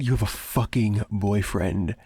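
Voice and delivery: "fucking" hit hard, falling statement; indignant. Acted. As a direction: repeat it as an angry accusation. Repeats the news, now angry.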